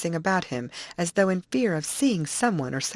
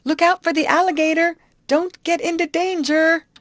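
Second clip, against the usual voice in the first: high, loud sustained shout; urgent high-pitched holler; warning call; 12 semitones higher, 8 dB louder than usual. Acted. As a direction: shout a warning across the river. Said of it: "She called to him".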